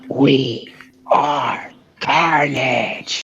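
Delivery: very slow, strained villain voice; drawn-out symbiote declaration; Carnage. Shown as a demonstration. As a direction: stretch each word out in a monster voice. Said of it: "my, my go like".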